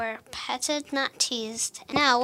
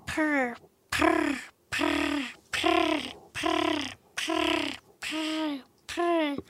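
cat purring; 3 semitones higher, much slower than usual. Acted. Cat refrain in a rhyme.

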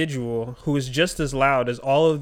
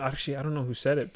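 ordinary delivery

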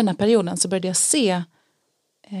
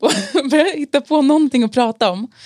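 laughing, higher and louder; 5 semitones higher, 3 dB louder than usual. Real, not acted. Laughing to the host about the awkward moment. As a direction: giggle while telling it.

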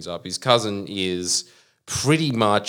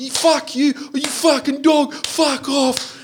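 high-pitched mock abuse; put-on raging bloke squawk; imitated mouthy bloke; 4 dB louder, slower than usual. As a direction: fire off hammed-up insults, pitched way up. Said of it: "be like".

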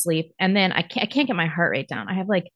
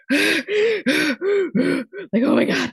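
breathless whispered shock, then rising higher; shocked gasp; 9 semitones higher than usual. Retold or imitated. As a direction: gasp the news in horror.

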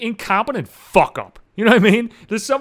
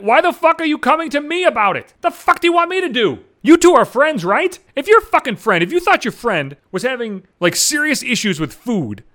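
high-pitched exasperated tirade; retold rant, pitched up in protest; indignant rant; 6 semitones higher, 3 dB louder than usual. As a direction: demand it, outraged at being dragged in.

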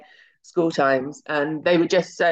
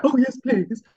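higher, keen little acceptance; eager yes please; 9 semitones higher than usual. Retold or imitated. Schoolgirl accepting the director's invite, eager and high. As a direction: jump at the offer, pitched up.

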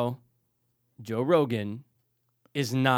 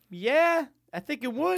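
mock high voice; 13 semitones higher, slower than usual. Retold own answer, mock voice.

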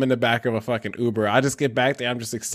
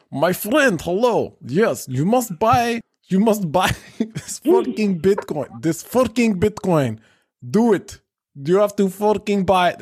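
hammed-up Russian accent, higher and slow; mock Russian accent; 8 semitones higher than usual. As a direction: play a pushy Russian scammer in a high, slow Russian accent. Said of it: "this Russian dude".

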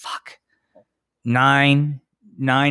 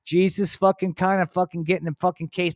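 normal talking voice

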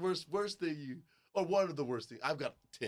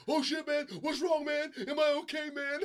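high mock panic; panicked student; 10 semitones higher than usual. Tandem student panicking mid-air, high mock fear. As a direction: squeak out a scared student's worry. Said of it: "'cause they be".

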